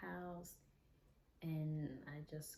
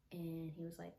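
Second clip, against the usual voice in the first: normal talking voice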